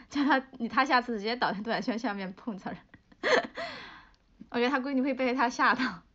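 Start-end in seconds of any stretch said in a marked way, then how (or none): 3.2-3.9 a sudden high squeal of a laugh; high burst of laughter. Real, not acted. Streamer laughing at own live joke, high squeal.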